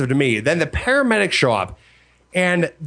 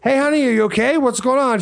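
concerned check-in; 8 semitones higher than usual. Retold or imitated. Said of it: "I'm just like".

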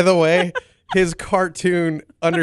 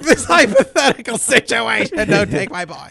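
higher, louder mock plea of a fretting mother; mocking worried mom; 7 semitones higher, 4 dB louder than usual. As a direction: ham up a mother clinging to her boy. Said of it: "it's just like".

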